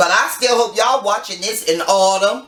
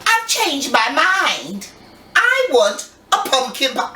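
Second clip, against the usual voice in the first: a hint of a child's voice, higher and slow; lightly voiced granddaughter; imitating granddaughter; 5 semitones higher than usual. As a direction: announce it like a decided little girl. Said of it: "She goes".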